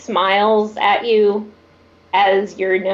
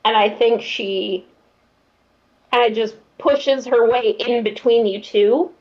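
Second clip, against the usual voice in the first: ordinary talk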